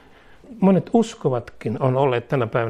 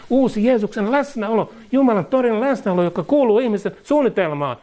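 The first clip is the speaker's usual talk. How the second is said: preaching that climbs higher with growing fervour; rising preaching; 6 semitones higher than usual. Acted. Preacher to congregation, climbing higher in ardent preaching. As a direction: build the sermon up to a ringing peak. Preaching climax about new relationship.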